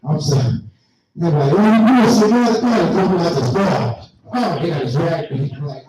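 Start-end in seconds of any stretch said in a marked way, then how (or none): 1.5-2.8 louder, higher, accusing cop voice; mock angry cop. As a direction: bark at him like a fed-up cop. Cop yelling at him.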